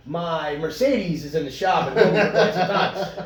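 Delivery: ordinary talk, nothing marked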